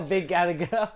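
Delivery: laughing through the words. Real, not acted. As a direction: talk while cracking up. Laughing while reacting to game.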